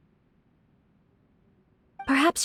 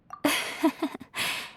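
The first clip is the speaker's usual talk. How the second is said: airy, voiceless chuckle; quiet giggle on the breath; soft laugh; 3 semitones higher, 6 dB quieter than usual. Acted. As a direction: laugh softly and politely. Character amused by kind words.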